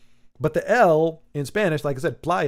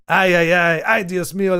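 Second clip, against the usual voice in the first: mock exasperation; 3 semitones higher, 5 dB louder than usual. Mock exasperated Spanish exclamation.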